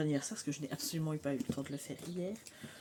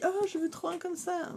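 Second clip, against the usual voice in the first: put-on much higher, childlike daughter voice; imitating daughter; 13 semitones higher, 4 dB louder than usual. Mother quoting her daughter wanting the oracle, childlike voice. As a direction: mimic the eager little girl begging for it. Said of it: "elle m'a dit".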